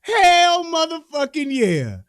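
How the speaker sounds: slow, loud triumphant cheer; excited cheer. Real, not acted. Cheering her return fire, slow and loud. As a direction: drag it out in triumph.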